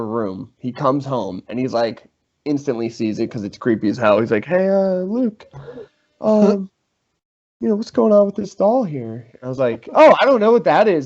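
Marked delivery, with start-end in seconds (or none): 4.4-9.3 higher, hesitant imitation of an uneasy roommate. Imitating nervous roommate finding doll. 9.9-11.0 higher, louder mock surprise; feigned innocence. Feigning innocence about planted doll.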